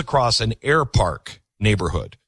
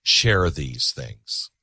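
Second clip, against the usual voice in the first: earnest appeal; 6 semitones lower, slower than usual. Real, not acted. Host closes with earnest appeal.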